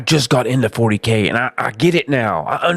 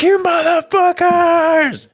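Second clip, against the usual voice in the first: much higher, slow shouted curse; rage yell; 4 dB louder, much slower than usual. Retold or imitated. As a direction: roar it at the enemies.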